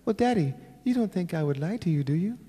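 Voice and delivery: high, soft imitation of a daughter; replayed little-girl plea. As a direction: play the innocent little kid asking daddy. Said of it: "I replay that thing".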